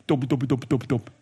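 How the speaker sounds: low mumbled impression of English as foreigners hear it; mock English gibberish. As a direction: parody English as dull, low babble. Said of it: "I think we sound like this".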